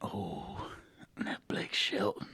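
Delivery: breathy teasing whisper, slowed. Real, not acted. Teasing a cohost about his crush, whispered. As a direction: hush it like a juicy secret.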